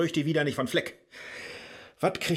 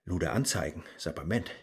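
ordinary talk, nothing marked

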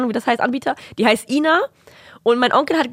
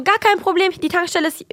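lightly voiced friendly neighbor, higher; a hint of an eager helper, pitched up; helpful neighbor; 7 semitones higher than usual. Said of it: "Er so". (leicht gespielter hilfsbereiter Nachbar, höher)

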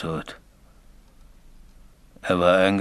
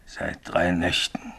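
drained confession; 3 semitones lower, 10 dB quieter than usual. Acted. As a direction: confess it faintly, worn down. Exhausted confession of ghost's return. (sehr leise, erschöpft und bedrückt)

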